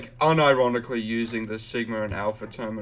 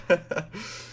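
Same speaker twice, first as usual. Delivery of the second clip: high giddy laugh; delighted laugh; 7 semitones higher than usual. Real, not acted. Laugh after shiny.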